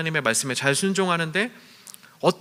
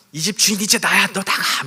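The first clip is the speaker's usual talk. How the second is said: put-on high, loud cry of an old woman claiming her house; imitated yelling; 7 semitones higher, 4 dB louder than usual. Elderly mother ordering out whatever lingers in her house, mock yelling. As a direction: yell it out, imitating the indignant new owner. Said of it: "막 이러면서 소리를 지르고 다니셨대요".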